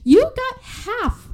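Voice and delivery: high-pitched exasperated questioning; incredulous. Acted. Storyteller scolding Leanne over her spent half, incredulous. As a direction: take her to task in mock disbelief.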